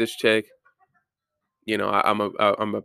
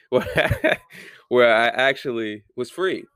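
voice cracking; slower than usual. Real, not acted. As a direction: voice cracking through a laugh. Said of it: "my voice is cracking".

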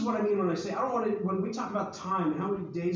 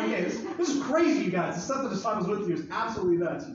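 ordinary talk

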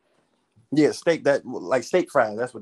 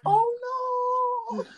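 dismayed oh no. Retold or imitated. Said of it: "I was like".

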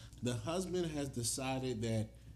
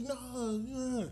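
high mock hesitation; 11 semitones higher than usual. Voicing himself hesitantly.

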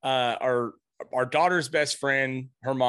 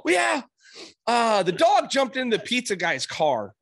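imitating son; 8 semitones higher than usual. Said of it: "He's all".

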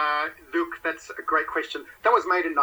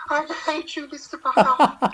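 little impish voice; 5 dB louder than usual. Retold or imitated. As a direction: spit it like a giggly sneering imp. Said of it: "she says in that little impish voice".

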